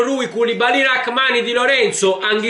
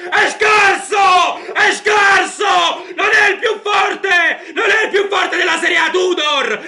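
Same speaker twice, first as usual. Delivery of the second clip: goal celebration shout; 7 semitones higher than usual. Real, not acted. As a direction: yell it out over the goal. Celebrating goal, mocking critics.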